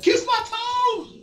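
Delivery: very high, drawn-out taunting cry; mock taunt. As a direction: crow it like a teasing sibling. Retold sibling wrestling taunt.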